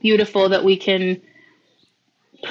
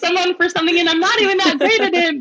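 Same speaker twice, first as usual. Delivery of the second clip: awkward disbelief; 10 semitones higher, 3 dB louder than usual. Retold or imitated. Retelling her own awkward reply.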